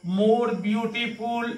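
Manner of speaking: very slow, drawn-out teacher's answer; slow model answer. Shown as a demonstration. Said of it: "क्या हो जाएगा इसका CD?".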